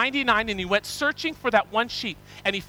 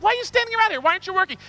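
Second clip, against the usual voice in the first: much higher, incredulous questioning; surprised landowner; 9 semitones higher, much faster than usual. Acted. Landowner questioning the idle workers, incredulous. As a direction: demand an answer in disbelief.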